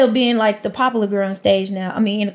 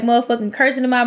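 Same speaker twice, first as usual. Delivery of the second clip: ordinary talk, nothing marked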